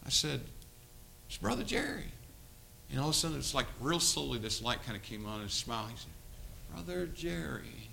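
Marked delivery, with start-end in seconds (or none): 1.3-2.1 high-pitched gentle reminder of who it is; retold coaxing introduction. Retold coaxing the vacant boy. 6.7-7.9 soft, drawn-out imitation of a drugged boy; slow dazed speech. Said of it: "And he talked like that".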